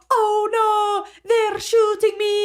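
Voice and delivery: high, slow, hammed-up Irish accent; imitating a friend's goofy Irish accent in a game; silly Irish accent. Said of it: "he literally does this fucking stupid ass Irish accent".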